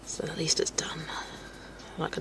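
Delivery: whispered aside to herself; real whisper while concentrating. Real, not acted. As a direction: breathe it out under your breath. Muttering while painting.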